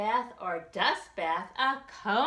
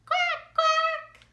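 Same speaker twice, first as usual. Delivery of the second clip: very high, loud duck quacking; 5 dB louder than usual. Acted. Reader voicing the duck, a very high loud quack.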